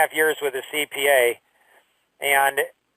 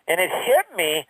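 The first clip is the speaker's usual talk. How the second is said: ordinary talk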